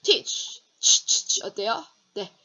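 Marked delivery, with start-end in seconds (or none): 0.8-1.4 "치, 치, 치" voiceless and louder; teacher drilling the ch in Teaches; ch sound drill. Shown as a demonstration. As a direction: hiss out the ch sound. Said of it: "발음하기 힘들어요".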